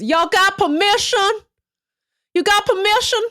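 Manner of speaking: foghorn leghorn impression. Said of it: "this foghorn leghorn ass motherfucker".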